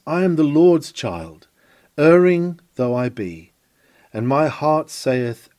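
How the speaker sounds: usual speaking voice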